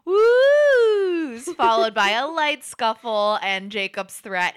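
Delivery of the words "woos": long, high, loud "woo"; teasing drawn-out whoop, high and loud; chorus of woos. Acted. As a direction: hoot it out high like rowdy friends. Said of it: "in a chorus of".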